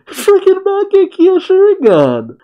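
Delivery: very high, slow, loud squeal; squealing disbelief. Real, not acted. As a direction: drag it out in a shrieking mock-awed tone. Exaggerated incredulous exclamation.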